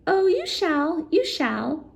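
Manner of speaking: high, slow sly promise; grinning goblin. Acted. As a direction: grin it out knowingly. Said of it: "grinned the goblin".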